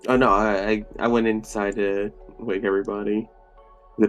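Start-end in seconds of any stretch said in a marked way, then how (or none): none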